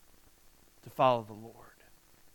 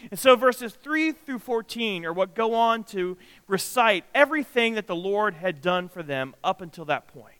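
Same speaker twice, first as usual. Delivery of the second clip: ordinary talk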